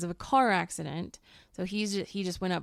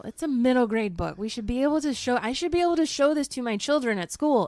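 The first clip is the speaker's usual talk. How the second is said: exasperated rant; 5 semitones higher, 7 dB louder, faster than usual. Real, not acted.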